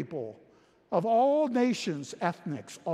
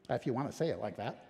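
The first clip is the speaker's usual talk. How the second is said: usual speaking voice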